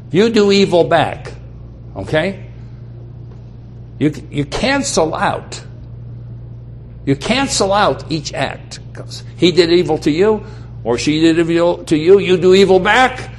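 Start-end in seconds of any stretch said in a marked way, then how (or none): none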